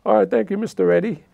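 high, loud forced cheer; guilty boy faking breezy thanks; nervous kid. Retold or imitated. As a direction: act innocent, chirpy.

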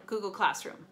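plain reading voice

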